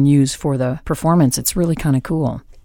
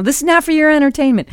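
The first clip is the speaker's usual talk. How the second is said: high-pitched mock reprimand; scolding coach; 11 semitones higher than usual. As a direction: tell off a giggler, imitating a coach. Acts out coach scolding laughter.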